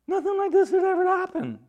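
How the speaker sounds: high, quick burst of astonishment; shocked amazement. Acted. Preacher voicing the shocked crowd, high amazement. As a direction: rush it out high, as if stunned.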